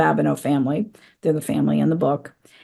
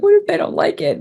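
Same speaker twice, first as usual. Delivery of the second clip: anxious worry; 13 semitones higher than usual. Retold or imitated. Imagined worry facing book club readers.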